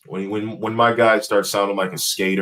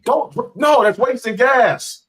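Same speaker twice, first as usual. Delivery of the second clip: mock stingy scold; 6 dB louder, much slower than usual. Mock-scolding wife about gas.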